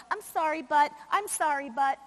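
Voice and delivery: mock apologetic hedging; fake-sorry and trailing off; passive-aggressive. Speaker imitating herself in a conflict with another educator, passive-aggressive. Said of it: "I'm usually passive-aggressive and go".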